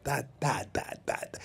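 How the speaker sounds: ordinary delivery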